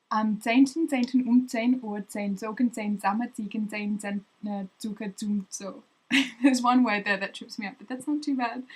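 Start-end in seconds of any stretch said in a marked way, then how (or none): 0.1-5.8 a quicker run through the twister; faster. Shown as a demonstration. Retrying the Z tongue twister for viewers, faster. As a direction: rattle the Z words off faster. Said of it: "Try that one faster".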